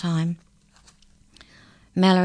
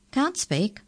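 higher-pitched questioning echo; surprised echo; 6 semitones higher than usual. A narrator's reading. Incisor echoes Murray in surprise.

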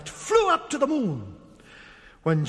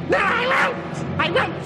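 high screeched refusal; defiant witch; 12 semitones higher, slower than usual. A narrator's reading. Witch told by God to go away, defiant screech. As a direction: screech it in stubborn witch fury. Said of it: "said the witch".

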